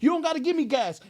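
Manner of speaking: excited. Retold or imitated. Eager friend offering a ride.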